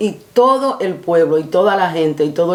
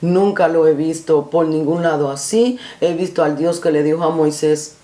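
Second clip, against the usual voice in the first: ordinary talk, nothing marked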